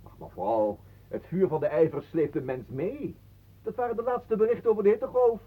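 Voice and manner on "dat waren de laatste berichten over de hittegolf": mock newsreader. News sign-off parody.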